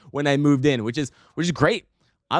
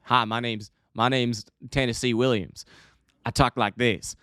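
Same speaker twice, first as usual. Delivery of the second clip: put-on vague Southern accent; Tennessee Williams, Southern drawl. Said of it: "is that a Tennessee accent?".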